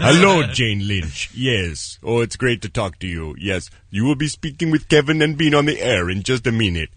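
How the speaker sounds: [name]'s producer voice. Retold or imitated. Cohost as producer talking to a celebrity guest, low and stately. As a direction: address the guest with grave, low formality. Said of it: "Why are you doing Sulu".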